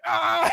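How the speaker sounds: high mock yell; imitated scream. Imitates man spinning on rope.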